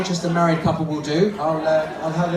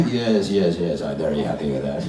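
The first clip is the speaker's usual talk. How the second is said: ponderous deep male character; man's dummy voice; 10 semitones lower than usual. Acted. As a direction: sink low as the husband dummy. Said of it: "What about a voice like this?".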